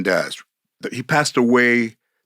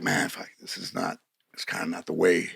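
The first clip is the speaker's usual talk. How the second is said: under-the-breath grumble, nearly whispered; muttered aside; 5 semitones lower, 10 dB quieter than usual. Retold or imitated. Actor grumbling to himself about bad direction, hushed. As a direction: keep it to yourself, barely voiced.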